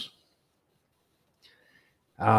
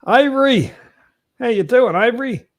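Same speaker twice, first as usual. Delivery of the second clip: loud, high call across the room; 12 semitones higher, 7 dB louder than usual. Real, not acted. Calling out to a guest.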